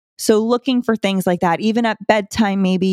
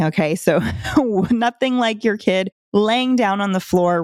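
usual speaking voice

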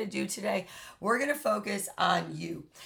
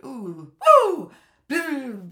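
excited mock whoop; 4 semitones higher, 8 dB louder, much slower than usual. Rat high on cocaine.

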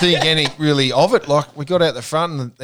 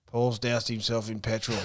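slow, unbothered retelling voice; mock-calm, slow and matter-of-fact; imitated calm; 2 semitones lower, 8 dB quieter than usual. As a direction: report disaster as if it were routine. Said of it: "So calmly".

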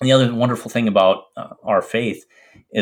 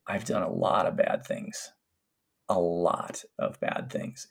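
whispered, much softer and slow; hushed confession; 4 semitones lower, 10 dB quieter, slower than usual. Retold or imitated. Patient confessing to her doctor, whispered and slow. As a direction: breathe it out low, like a shameful secret.

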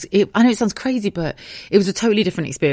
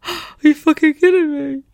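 high, loud horrified disbelief; shocked disbelief; 9 semitones higher, 4 dB louder, slower than usual. Retold or imitated. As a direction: react to the worst news possible. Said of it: "I was like".

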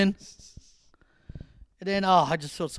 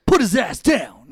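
mock tough shout; 4 semitones higher, 10 dB louder, slower than usual. Acted wrestler quote, slow loud.

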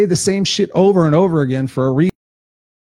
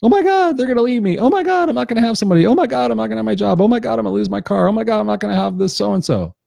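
much higher, fast, frantic repeated oh my god; mock panic; 9 semitones higher, faster than usual. Imitating a fearful person panicking about losing everything. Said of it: "What is fear?".

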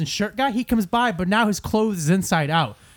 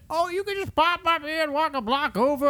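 put-on old lady; 10 semitones higher, 4 dB quieter than usual. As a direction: imitate an old lady chirping directions. Mimics old lady's reply.

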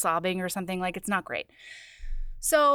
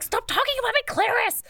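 exasperated; 12 semitones higher than usual. Retold or imitated. Heroine exasperated at dragon.

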